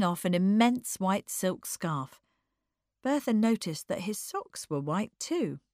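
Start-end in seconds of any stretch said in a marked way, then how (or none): none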